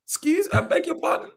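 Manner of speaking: hammed-up shock at a parent's excuse; mock disbelief. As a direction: pitch jumps way up on the what, mock outrage.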